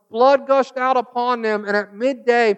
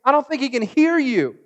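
higher, teasing jab at false prophets; mocking; 5 semitones higher than usual. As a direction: taunt them lightly. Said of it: "He's mocking them".

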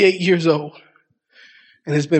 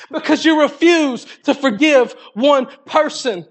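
preaching at full force; 10 semitones higher than usual. Acted. Preacher retelling Matthew eighteen to the congregation, full-force preaching.

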